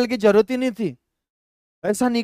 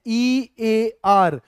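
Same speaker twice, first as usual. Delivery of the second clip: letters spelled one by one, very slow; slow teacher spelling; spelled out slowly; much slower than usual. Shown as a demonstration. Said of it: "ध्यान से सुनना".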